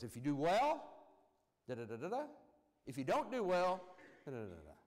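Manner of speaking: ordinary delivery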